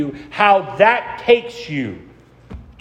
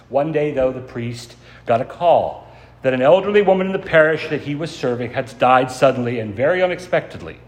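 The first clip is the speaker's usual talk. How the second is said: usual speaking voice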